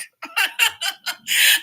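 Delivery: loud high laugh; loud laugh at herself. Real, not acted. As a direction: burst out laughing loudly. Laughing at own bad accent.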